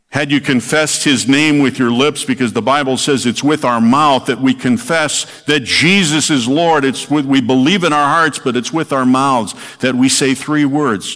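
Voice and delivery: pulpit-pounding sermon voice; fervent preaching. Acted. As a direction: preach it hard, building fervour. Preacher rises to full force.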